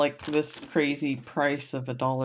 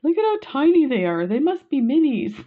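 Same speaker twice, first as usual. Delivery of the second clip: high, loud, delighted exclamation; retold surprise at tiny beads, high and bright; amazed inner voice; 10 semitones higher, 6 dB louder than usual. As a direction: squeal at how tiny they are. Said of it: "I'm like".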